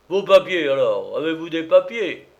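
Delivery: loud, peremptory demand; loud officer's challenge; stern gendarme. A narrator's reading.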